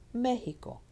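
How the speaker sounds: high "Me" in "Mexico", then falling; Spanish "Mexico" as ME-hi-co; stress on ME. Shown as a demonstration. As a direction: lean on the first syllable of "Mexico". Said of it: "It's here on the me".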